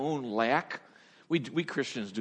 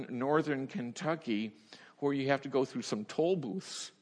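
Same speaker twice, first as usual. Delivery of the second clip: normal talking voice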